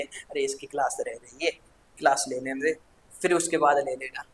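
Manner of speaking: soft, low mother's voice; mother calming. Acted. Mother telling a child to let sibling study, softly. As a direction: hush the child gently as a mum. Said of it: "mummy बोलती है".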